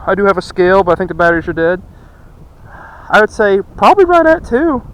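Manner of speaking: high, loud, pleased estimate; bragging about the catch; excited. Real, not acted. Estimating the weight, thrilled.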